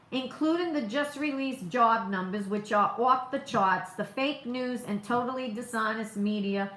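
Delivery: low, soft read-out of a statement; reading tweets aloud. Shown as a demonstration. Reading the president's tweets to viewers, low and soft. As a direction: read the post aloud, measured and quiet.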